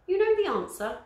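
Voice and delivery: high loud eager question; excited. Acted. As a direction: squeal it hopefully. Flavia excited.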